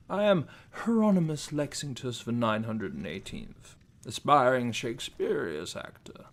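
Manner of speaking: ordinary talk, nothing marked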